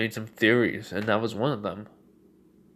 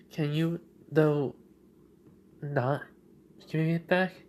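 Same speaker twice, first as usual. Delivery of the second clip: put-on higher, halting young girl's voice; hesitant Eri; 6 semitones higher than usual. Young girl asking the shadow about her quirk, hesitant high voice. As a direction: ask it high, with hesitant pauses. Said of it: "and she does say".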